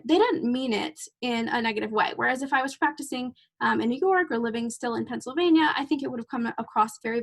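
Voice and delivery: normal talking voice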